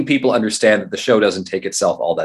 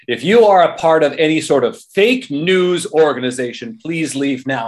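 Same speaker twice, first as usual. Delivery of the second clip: lightly hammed-up emcee voice, higher; mock MC announcement; 6 semitones higher than usual. MC telling fake news to leave, mock announcement. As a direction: play the emcee warning the crowd.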